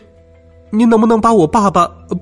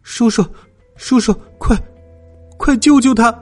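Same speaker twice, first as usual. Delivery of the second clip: high, halting plea; frightened child begging a grown-up; panicked stammer; 8 semitones higher than usual. A narrator's reading. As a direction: stammer it out in alarm, pitched way up. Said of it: "结结巴巴地说".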